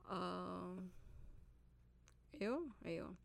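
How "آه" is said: ordinary talk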